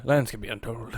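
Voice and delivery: a hint of a pirate; lightly voiced pirate line. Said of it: "spoken like a pirate right there".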